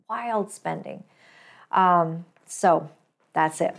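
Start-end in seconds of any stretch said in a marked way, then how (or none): 0.1-1.0 echoed under the breath, much softer; repeating wild spending as a sour aside to listeners; quiet repeat. Shown as a demonstration. Muttered wild spending echo.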